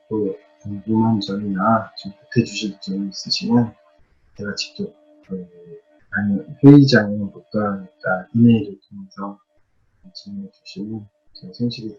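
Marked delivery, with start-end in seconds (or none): none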